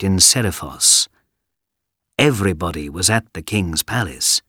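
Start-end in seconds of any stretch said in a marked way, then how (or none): none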